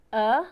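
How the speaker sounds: short "Uh" sound, clear and open; teacher modelling "Uh"; vowel sound demo. Shown as a demonstration. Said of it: "The letter U".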